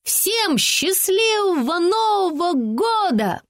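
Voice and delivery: high, slow shout out the window. A narrator's reading. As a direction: call it out into the dark night. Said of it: "высунулись из окна и крикнули".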